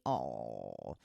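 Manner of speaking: low, soft tender aw. Real, not acted. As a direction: melt a little. Touched by pet message.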